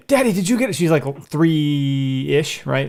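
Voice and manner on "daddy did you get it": put-on high, childlike little girl asking daddy; little daughter voice. Dad mimics his toddler daughter's eager question on FaceTime. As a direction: play the three-year-old, way up high.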